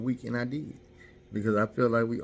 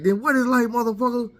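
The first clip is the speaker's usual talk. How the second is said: high, loud furious yelling; wife yelling she is leaving, furious; angry wife; 12 semitones higher, 6 dB louder than usual. Retold or imitated. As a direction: scream it at him as you walk out. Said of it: "She like".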